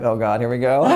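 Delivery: amused resigned sigh with a laugh; mock dread, chuckling; laughing groan. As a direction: brace for the fuss, laughing. Embarrassed at being recognized.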